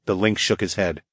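usual speaking voice

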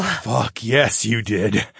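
lightly grunted. A narrator's reading. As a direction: grunt it with satisfaction. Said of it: "I grunted".

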